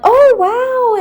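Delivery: very high, loud imitation of amazement; hammed-up astonishment, shooting up in pitch; mock disbelief. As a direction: exaggerate someone's incredulous reaction. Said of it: "this different expression of, like, complete disbelief".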